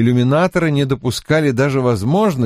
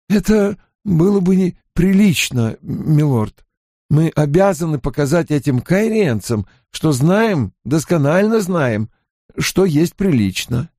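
higher-pitched, earnest servant voice; Hurin, ceremonious retainer; 6 semitones higher than usual. Acted. Hurin explains proper conduct to his lord, ceremonious. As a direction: insist on propriety, pitched up.

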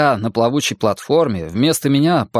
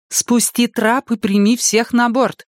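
high, slow, firm order; decisive command; 11 semitones higher than usual. Acted. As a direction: cut him off and command it. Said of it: "решительно перебила его Энея".

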